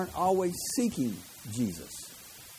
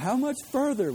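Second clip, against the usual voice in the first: put-on whiny kid; 9 semitones higher than usual. Pastor imitates teenagers on a long car trip, higher whine. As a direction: mimic an impatient child in the back seat. Kids whining on long trips.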